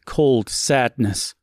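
plain reading voice

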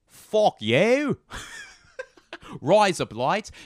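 high, loud, hammed-up Australian; Australian accent. Demonstrating Australian, high loud mock accent. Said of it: "then there's Australian".

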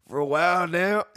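loud, high country twang; hammed-up country accent, played for laughs; exaggerated country accent. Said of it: "I don't know why I got country".

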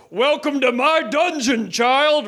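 mock roar of a giant; put-on roar, not fully shouted; roaring villain. A narrator's reading. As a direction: welcome them as a hammy giant villain. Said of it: "roared Goliath".

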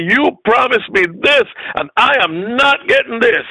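high, emphatic indignation; angry protest. Retold or imitated. Preacher retelling his complaint to God over the promise, angry. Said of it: "I got angry".

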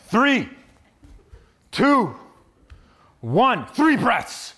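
loud drill countdown. Retold or imitated. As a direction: call each number like a trainer.

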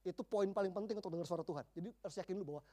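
ordinary talk

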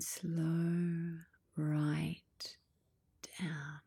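very slow, stretched words, fading on the last; drawn-out slowing. Acted. Guide has listeners see what draws them, very drawn-out slowing.

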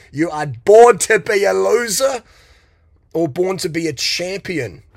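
loud, slow, emphatic declaration; shouted. Real, not acted. Coach shouting at viewers, loud and slow. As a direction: bellow it like a coach firing people up. Motivational rant, shouted line.

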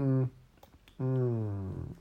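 swallowing mid-sentence; mumbling through a sip; while sipping a drink. Real, not acted. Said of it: "nicht schön anzuhören".

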